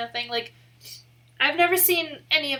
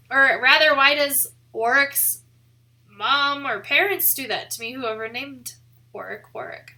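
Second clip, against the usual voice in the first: ordinary talk, nothing marked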